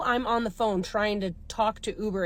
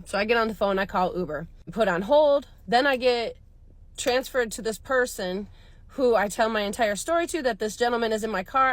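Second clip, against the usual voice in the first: normal talking voice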